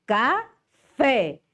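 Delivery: café said high with an open fé; model word. Shown as a demonstration. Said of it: "Onde é o acento?".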